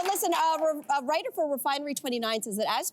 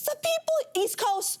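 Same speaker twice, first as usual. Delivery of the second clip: high, slow, delighted gush; amazed praise; 13 semitones higher, slower than usual. Retold or imitated. Visitor to Saint John's, high slow delighted gush. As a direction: gush about the friendly locals. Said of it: "And I just went".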